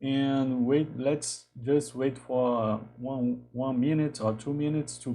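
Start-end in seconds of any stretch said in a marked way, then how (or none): none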